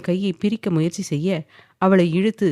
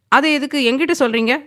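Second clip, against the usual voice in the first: quick, sharp, higher retort; snapped question; 7 semitones higher, faster than usual. A narrator's reading. Wife snapping back at her husband quickly. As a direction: fire the question back fast. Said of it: "பட்டென கேட்க".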